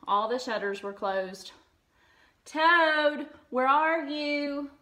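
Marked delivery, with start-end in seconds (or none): 2.4-4.7 loud, higher call, slow on the question. A narrator's reading. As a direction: search a dark room. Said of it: "called Frog".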